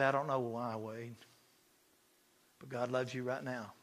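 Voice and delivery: low, hushed reassurance; tender comfort. Retold or imitated. Hugging a hurting man and consoling him, tender and quiet. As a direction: soothe him softly, with pauses.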